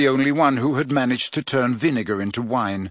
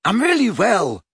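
happy; 8 semitones higher, 3 dB louder than usual. A narrator's reading. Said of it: "she said happily".

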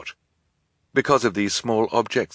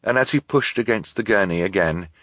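plain reading voice

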